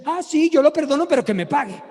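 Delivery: loud, high señora outburst; high and loud, falling onto the demand; insistent woman. Retold or imitated. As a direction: imitate a stubborn woman conceding impatiently. Retold señora refusing to really forgive.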